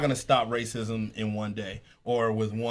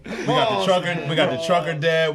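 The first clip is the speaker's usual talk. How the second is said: laughing; 5 semitones higher, 7 dB louder than usual. Real, not acted. Still laughing listing guests.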